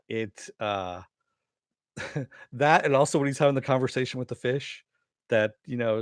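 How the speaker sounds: normal talking voice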